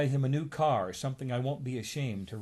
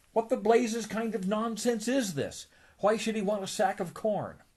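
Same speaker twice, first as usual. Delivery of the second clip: high, indignant sputter; exasperated outburst; 9 semitones higher than usual. Acted. Scrooge fuming at the hypnotist's nonsense, high exasperated cry. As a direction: blow up in disbelief.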